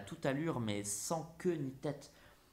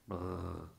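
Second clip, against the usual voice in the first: low rolled brrr; brrr noise; 6 semitones lower than usual. Acted. Picasso painting the dark night, low rolled brrr. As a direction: make a low trilled noise. Voicing the painter's strokes aloud.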